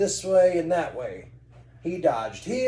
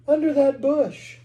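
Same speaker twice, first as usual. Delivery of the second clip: put-on high girl voice; 11 semitones higher, 7 dB louder than usual. Cindy points.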